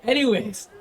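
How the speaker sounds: normal talking voice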